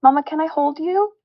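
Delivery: mother imitating her toddler's sweet question; little kid voice. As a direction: imitate a tiny child asking to be held. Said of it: "they would go".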